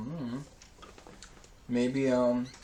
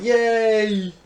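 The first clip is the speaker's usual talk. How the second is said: cheering; 8 semitones higher, 12 dB louder than usual. Retold or imitated. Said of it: "we would be like".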